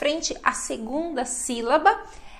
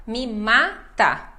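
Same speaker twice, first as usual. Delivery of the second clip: "mata" with a long, strong MA; slow "me MA-ta", short final syllable; penultimate stress; 3 dB louder, much slower than usual. Shown as a demonstration. Teacher using a song lyric as example, stressed MA in "mata". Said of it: "sempre a paroxítona".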